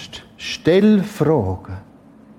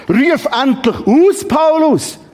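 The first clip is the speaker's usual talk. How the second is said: mock outcry; 10 semitones higher, 4 dB louder than usual. Urging Paulus to cry out.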